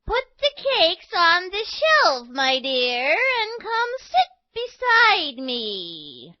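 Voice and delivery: high, slow, put-on granny voice; wolf faking granny. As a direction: lure her in sweetly.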